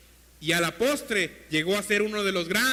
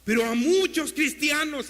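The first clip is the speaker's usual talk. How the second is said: raised, higher sermon voice, driving on; fervent preaching; 7 semitones higher, 5 dB louder than usual. Real, not acted. Preacher urging the church to learn God's voice, fervent preaching. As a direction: preach it out with urgency and force.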